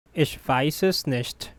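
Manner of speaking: measured and clearly enunciated. Shown as a demonstration. Teacher repeats slowly for learners.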